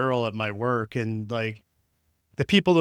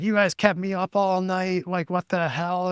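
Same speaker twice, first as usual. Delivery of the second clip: mock angry mom; 6 semitones higher than usual. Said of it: "screaming at this girl".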